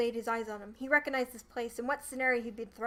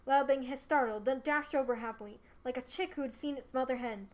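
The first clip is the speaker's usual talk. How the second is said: usual speaking voice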